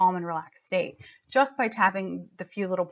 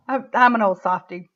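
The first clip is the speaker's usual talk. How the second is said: ordinary talk, nothing marked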